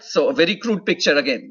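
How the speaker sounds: professor hits the word crude to warn students: crude stressed. Shown as a demonstration. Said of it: "Let me emphasize the word crude".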